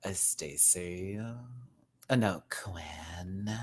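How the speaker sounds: slow, whispered mock cheerleader line; Sandi voice. Vlogger imitating a cartoon teen, slow mock whisper. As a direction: breathe it out slowly like a snooty fashion-club girl. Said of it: "Come on, get the voice".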